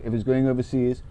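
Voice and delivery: normal talking voice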